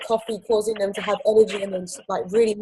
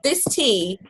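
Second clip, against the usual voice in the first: "This tea" drawn out slowly for effect; really slowly. Shown as a demonstration. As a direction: let each word of "This tea" sink in. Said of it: "I always say it really slowly".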